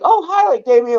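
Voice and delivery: high-pitched mock Beverly Hills girl greeting. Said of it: "blonde hair, blue eyes".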